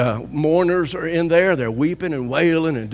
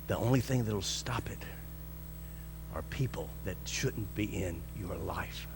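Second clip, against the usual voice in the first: hushed whisper; 9 semitones lower, 12 dB quieter than usual. Real, not acted. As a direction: whisper it, much softer and lower. Preacher drops to a hush.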